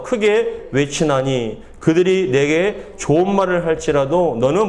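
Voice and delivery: very slow, drawn-out verse reading; congregational scripture reading. A narrator's reading. Pastor leads the congregation reading verse five together, slow and deliberate. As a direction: read in measured phrases so all can follow.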